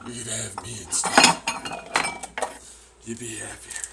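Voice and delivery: slow, loud, hissed temptation; creepy food offering itself; whispered tempter voice. Pretend. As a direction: whisper it slowly like a devil on the shoulder.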